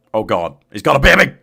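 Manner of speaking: frantic shouting to the dog to run; panicked yelling. Real, not acted. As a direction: yell for the dog to flee in a real panic. Panicked yelling at polar bears.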